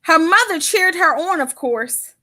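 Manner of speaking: put-on lively read-aloud to children; storybook reading. Author reading her book aloud to children. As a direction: read it like story time for kids.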